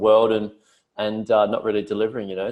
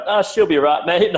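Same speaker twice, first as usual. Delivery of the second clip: higher, louder carefree Aussie bloke; laid-back Aussie worker; 8 semitones higher, 6 dB louder than usual. Retold or imitated. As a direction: toss it off like nothing could go wrong. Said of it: "and it's just like".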